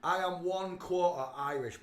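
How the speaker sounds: usual speaking voice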